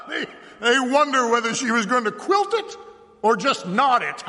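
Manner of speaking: high, loud, laughing mimicry; mocking, laughing. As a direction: laugh as you parrot the women's words. Sheriff mocks the women's question.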